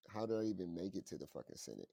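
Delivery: usual speaking voice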